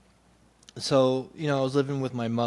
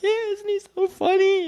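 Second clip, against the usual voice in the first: much higher, louder mock-sweet mother voice; high, loud parody of an oblivious mother; doting mother; 5 dB louder than usual. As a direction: gush like a doting mom.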